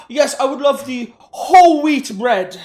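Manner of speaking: loud, drawn-out mocking customer voice; mock fussy customer. Mimicking a customer ordering at Subway, mocking and exaggerated. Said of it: "Who, who goes into a Subway and asks".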